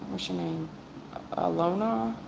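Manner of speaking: normal talking voice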